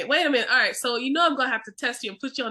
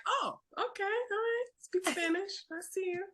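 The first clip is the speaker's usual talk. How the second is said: higher, softer impressed tone; impressed approval; 8 semitones higher, 9 dB quieter than usual. Retold or imitated. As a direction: sound quietly impressed. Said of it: "I want them to be like".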